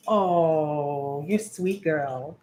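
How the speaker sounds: low, slow, tender coo to the dog. Real, not acted. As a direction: melt over her like a puppy lover. Cooing to her dog.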